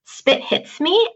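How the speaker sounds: "Spit hits me" with T's spat out hard and clear, slow; crisp spat T's. Shown as a demonstration. Teacher shows boys spitting out T's so clearly they reach the piano. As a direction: land every T of "Spit hits me" so it flies. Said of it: "if you can get the T so clear".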